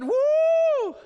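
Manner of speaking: high, loud whooping cheer; mock cheer. The congregation's pretend response to the commission, whooping loudly. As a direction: whoop like an excited crowd. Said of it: "their response is not".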